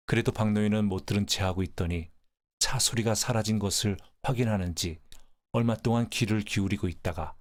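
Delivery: ordinary delivery, nothing marked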